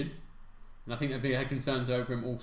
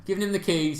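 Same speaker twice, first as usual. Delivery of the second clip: emphatic; 7 semitones higher, 6 dB louder than usual. Real, not acted. Emphatic echo of partner's remark.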